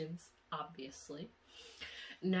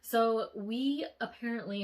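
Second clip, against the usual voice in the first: ordinary talk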